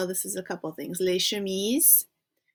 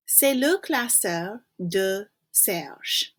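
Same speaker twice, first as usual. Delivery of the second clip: slow model sentence; much slower than usual. Shown as a demonstration. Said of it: "slow model answer sentence".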